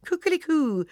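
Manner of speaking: very high, loud cock-a-doodle-doo; rooster crowing. A narrator's reading. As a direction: crow it like a rooster. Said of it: "gol tuppen".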